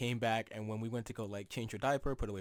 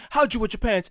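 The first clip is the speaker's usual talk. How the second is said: raised, higher scolding quote; mock yelling; 7 semitones higher, 9 dB louder than usual. Grandma to great-grandma about wet pants, mock yelling. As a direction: imitate an exasperated yell. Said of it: "My grandmother's yelling at her".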